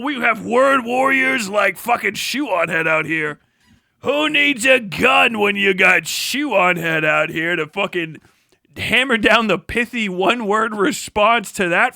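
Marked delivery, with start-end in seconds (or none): none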